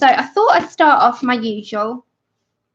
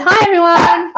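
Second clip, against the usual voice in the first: loud, higher call to the crowd; relayed greeting; 7 semitones higher, 6 dB louder than usual. Retold or imitated. Relaying partner's hello to stream viewers, calling out. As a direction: sing out a big hello. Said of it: "He said".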